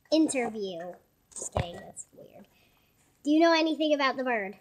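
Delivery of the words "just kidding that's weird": whispered aside; whispering. Real, not acted. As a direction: drop to a whisper.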